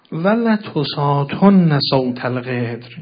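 slow, loud Arabic declamation; sermon recitation. Acted. Preacher opening the Imam's sterner phrase, solemn recitation. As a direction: proclaim it with long, weighty vowels.

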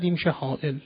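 ordinary delivery

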